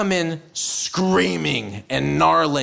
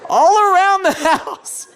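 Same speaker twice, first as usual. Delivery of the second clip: high, loud comic exclamation; incredulous outburst; 12 semitones higher, 6 dB louder than usual. Acted. Storyteller describing screams round the house, comically alarmed outburst. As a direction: burst out loudly in disbelief. Exasperated account of screams outside.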